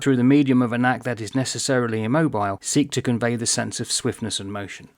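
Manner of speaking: brisk, run-on read-aloud; quick reading. Shown as a demonstration. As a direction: rattle through the long sentence without lingering. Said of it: "I'll read it as though you were reading quickly".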